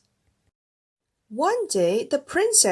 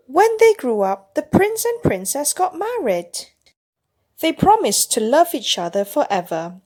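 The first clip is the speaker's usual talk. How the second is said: put-on louder, read-aloud ending to a child; a fond storybook close; 4 semitones higher, 9 dB louder than usual. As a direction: wrap the tale up for the little listener.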